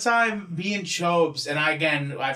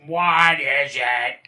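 drawn-out mock grumpy answer; bad roommate; 4 semitones lower, 3 dB louder, much slower than usual. Roommate answering pranksters at his house, imitated drawn-out grumble. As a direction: imitate a put-upon friend, dragging out the words. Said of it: "doing the Marshall fucking bad roommate voice".

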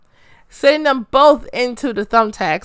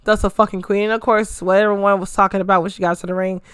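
normal talking voice

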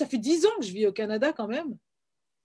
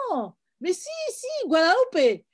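higher, mock-insistent contradiction; insistent back-and-forth; 7 semitones higher than usual. Americans insisting she means Guadalupé, imitated insistently. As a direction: imitate a stubborn argument, pitch jumping up. Acted argument with confused Americans.

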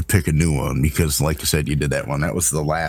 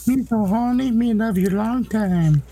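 high, slow silly voice; hammed-up movie quote voice; silly clown. Quoting old movie line, kids reference.

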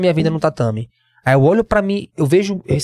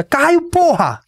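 retold shout; 11 semitones higher, much slower than usual. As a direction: shout his name to stop him. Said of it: "Rafael Feijão grita".

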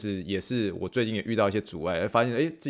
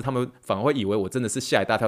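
normal talking voice

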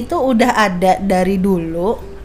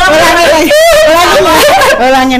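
laughing while talking, high and loud; giggling through the words; 6 semitones higher, 7 dB louder, faster than usual. Real, not acted. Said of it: "Jangan bikin gua ketawa".

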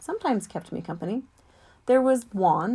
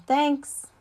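higher, called-out thanks; yell; 8 semitones higher, 4 dB louder than usual. Acted. George calling thanks after Mac out the door, mild yell. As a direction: shout it after someone leaving. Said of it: "before George can yell".

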